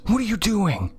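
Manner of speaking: high, sharp sister's demand; indignant high-pitched challenge; snapped. A narrator's reading. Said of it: "she snapped".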